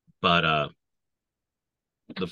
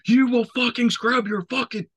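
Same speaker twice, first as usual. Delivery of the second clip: very high, loud enraged order; imitating a pissed-off sergeant yelling; furious yell; 6 dB louder than usual. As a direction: bellow it like a raging sergeant. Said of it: "he was just so fucking pissed".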